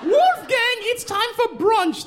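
very high sing-song "time for brunch"; posh mother calling. Retold or imitated. Building mother calls Wolfgang to brunch, very high. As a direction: call him to brunch airily. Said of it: "That's what the woman said".

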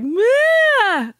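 very high, loud sudden bleat; weird noise. Retold or imitated. Mother imitating her daughter's odd stroke cry, high sudden bleat. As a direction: imitate the strange cry she heard.

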